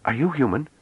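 high, tentative question; timid, rising query; hesitant question. A narrator's reading. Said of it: "He said hesitantly".

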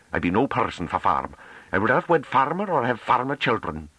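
high, determined rustic woman's voice; a country girl insisting on her dream; young Hamish woman, high and fiercely earnest; fierce farm girl. A narrator's reading. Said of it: "Sura Novi drove on fiercely".

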